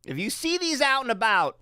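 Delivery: ordinary talk, nothing marked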